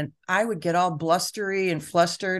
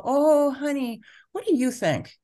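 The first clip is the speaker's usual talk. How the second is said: put-on higher, sweet mom asking her child; 7 semitones higher than usual. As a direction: gently turn the question back to the child. Mother answering her daughter.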